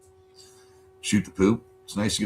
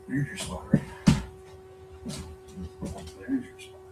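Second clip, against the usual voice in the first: soft whisper, rising higher; whispered coaxing; 9 dB quieter than usual. Real, not acted. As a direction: gently whisper the cat over to its spot. Coaxing cat to its spot.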